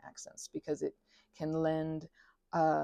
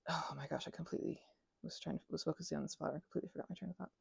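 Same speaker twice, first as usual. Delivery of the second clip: muttering to self; 12 dB quieter, faster than usual. Real, not acted. Vlogger realising she forgot her train of thought, muttering. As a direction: mutter it almost under your breath.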